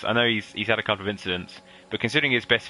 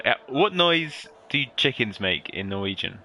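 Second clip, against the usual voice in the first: ordinary delivery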